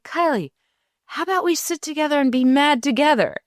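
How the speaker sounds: mother offering to sit with angry daughter, high and quick; playful invitation. Retold or imitated. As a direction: invite her in like a game.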